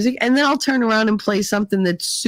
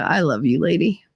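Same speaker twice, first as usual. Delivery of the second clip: ordinary talk, nothing marked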